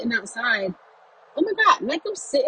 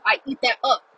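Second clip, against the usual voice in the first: louder, punching up; emphatic; 2 semitones higher, 4 dB louder than usual. Real, not acted. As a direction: declare it with relish. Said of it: "Okay, I do".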